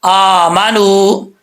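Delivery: आमानू with a long drawn first vowel; drawn-out recitation. Shown as a demonstration. Teacher recites आमानू slowly for students. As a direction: stretch आमानू slowly, Quran style. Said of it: "आगे है आमानू".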